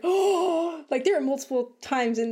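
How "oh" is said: loud sharp gasp of shock; a sharp loud gasp, then a slow drawn oh. Retold or imitated. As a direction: gasp as the logo appears. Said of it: "I had to gasp really loud".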